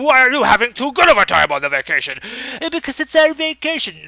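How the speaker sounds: high, loud, fast exasperated whine; mock shrill nagging. Dax nagging Worf about the vacation, hammed-up high whine. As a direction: pile on the complaint.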